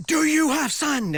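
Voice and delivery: high-pitched, drawn-out angry demand; almost yell it, slow and high; near yell. Retold or imitated. Security guard confronts traveler over sand, near yell. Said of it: "almost yells at me".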